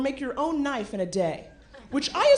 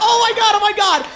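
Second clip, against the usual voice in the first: higher, louder oh my God, laughing; shocked; 9 semitones higher, 9 dB louder than usual. Acted. Hearing the dessert name, loud shocked disbelief. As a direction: gasp it out in disbelief.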